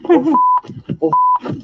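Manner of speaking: shrieking high in panic; panicked squeal. Real, not acted. Gamer squealing at the screen in fright.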